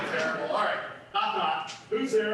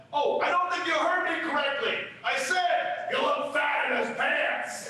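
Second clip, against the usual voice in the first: loud shout; 5 semitones higher, 6 dB louder than usual. Acted. Bear repeats insult loudly.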